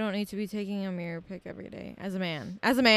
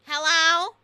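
mock rude customer calling hello; loud rising hello over the speaker; 6 semitones higher, 7 dB louder than usual. Drive-thru worker imitating a rude customer, loud. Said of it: "you bitches come in and you're like".

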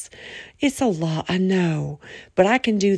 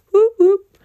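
much higher and louder whoop; cheering whoop; 9 dB louder than usual. Real, not acted. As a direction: celebrate out loud.

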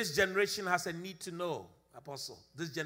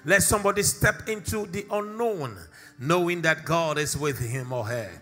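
rising, louder prayer building to a plea; fervent prayer; 7 dB louder than usual. Acted. Pastor praying over the congregation, fervent and loud. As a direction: call on God with mounting fervour.

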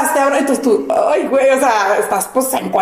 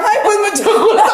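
awkward laughing; 8 semitones higher than usual. Retold or imitated. Awkward greeting, naked before stranger.

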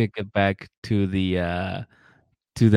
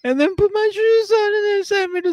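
put-on much higher, a babyish pleading voice; childish whine. Mocking a grown man asking to be babied, high childish whine. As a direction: whine like a kid who wants tucking in. Mocks being babied by partner.

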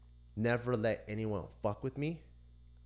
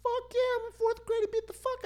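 very high, loud tearful whine; mock crying; 5 dB louder than usual. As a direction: wail it like a beaten fourth-grader. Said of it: "Were you crying?".

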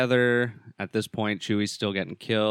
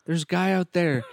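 put-on alarmed girl; 9 semitones higher than usual. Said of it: "She's like".

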